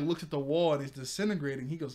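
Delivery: ordinary delivery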